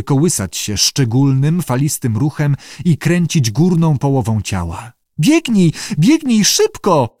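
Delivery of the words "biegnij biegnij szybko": high, panicked sobbing cry; sobbing plea. A narrator's reading. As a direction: beg him in tears to run. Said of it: "załkał koteczek".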